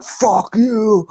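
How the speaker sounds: high, drawn-out mock French-Canadian drawl; hammed-up drunk with a French accent. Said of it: "has, like, a French accent".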